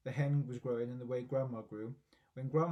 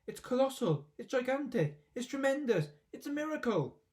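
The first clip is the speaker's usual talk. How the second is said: very high, building ecstatic cries; 9 semitones higher, 3 dB louder than usual. A narrator's reading. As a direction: rave while dancing round. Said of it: "Mister Cranky shouted".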